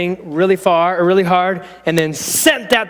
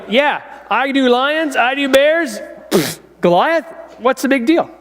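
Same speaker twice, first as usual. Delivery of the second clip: cocky boast; 6 semitones higher than usual. Acted. Boy David boasting to Saul.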